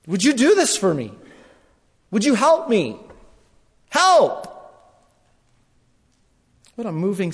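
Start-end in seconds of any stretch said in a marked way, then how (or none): 0.1-4.5 rising to a high, loud cry for help; pleading that builds into a shout; desperate pleading. Acted. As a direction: beg harder and harder until you cry out. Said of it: "just kept asking".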